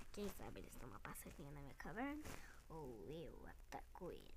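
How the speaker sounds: low, soft villain creature; deep monster voice. Pretend. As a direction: purr like a sly predator dinosaur. Toy predator boasting.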